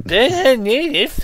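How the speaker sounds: high, slow whining; whiny kid voice. Retold or imitated. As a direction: whine it like a spoiled kid.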